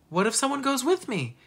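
put-on high-pitched child's plea; narrated girl begging for a yes; pleading. A narrator's reading. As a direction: bargain hopefully with parents. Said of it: "Hannah pleaded".

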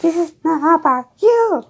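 high eerie ghost voice. Acted. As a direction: float it up high and hollow like a ghost. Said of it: "I can do a ghost voice".

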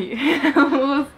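high strained cackle; strained laugh. Real, not acted. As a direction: laugh till the voice cracks. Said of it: "that took a lot off from my vocal cords".

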